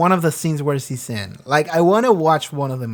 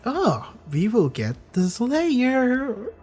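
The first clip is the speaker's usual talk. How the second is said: higher, very slow hammed-up villain line; cartoonishly evil villain; 6 semitones higher, 4 dB quieter, much slower than usual. The Master vowing to get the Slayer, slow cartoon-evil voice. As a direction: savour it like a cartoon bad guy. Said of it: "cartoonishly evil".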